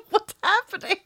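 baffled. Retold or imitated. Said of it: "I was just".

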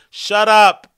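loud snap. Acted. Snapping back at the critic.

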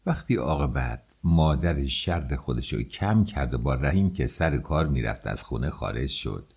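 usual speaking voice

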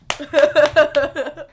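loud, high imitation of forced laughter; trying a real laugh. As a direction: overdo a badly faked laugh. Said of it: "He's trying to real laugh".

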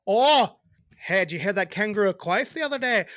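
put-on loud, botched Australian accent, nearly Irish; hammed-up Australian slipping into Irish; Irish-sounding accent attempt. Host tests an Australian accent, comes out sort of Irish. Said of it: "That's sort of Irish".

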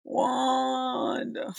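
wailing a name in mock grief; dramatic cry of a name. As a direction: call the name out like a dramatic sob. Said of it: "Dude was dramatic".

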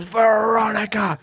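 high, slow shout of the name; teacher calling a name. Retold or imitated. Teacher catching the girl red-handed, high slow shout. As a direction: snap the name out like a caught-you. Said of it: "She goes".

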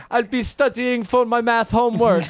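high-pitched mock Jamaican accent. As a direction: put on a Jamaican kid's voice. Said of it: "they have Jamaican accents".

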